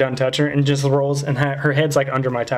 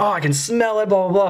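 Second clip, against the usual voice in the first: high-pitched imitation of the guard; mock amazement; 6 semitones higher than usual. Guard leans into the smelly car, amazed. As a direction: exclaim as the guard, tailing into blahs.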